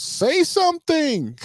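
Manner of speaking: high, drawn-out mock shriek; imitated child's scream. Dad imitating his daughter demanding an answer, shrieked. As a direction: mimic a kid screaming in a tantrum. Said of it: "she just kept screaming".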